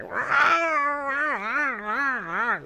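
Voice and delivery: screechy high cat cry; cat yowl imitation. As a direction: wail like a cat at night. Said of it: "he just starts cowling".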